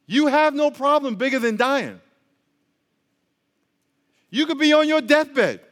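ordinary delivery

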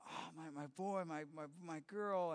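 soft, slow, choked-up murmur; tearful whisper of love; crying. Retold or imitated. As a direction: say it as if fighting back tears. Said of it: "She started crying".

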